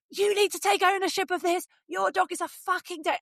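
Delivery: high-pitched angry outburst, acted; re-enacted screaming rant. Dog owner re-enacts yelling at the neighbours, screaming. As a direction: re-enact kicking off, voice jumping way up. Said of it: "I was screaming so much".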